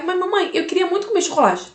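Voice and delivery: lightly voiced begging little girl; pleading daughter. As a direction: plead like a child asking mum for a treat. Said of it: "Aí ela vai".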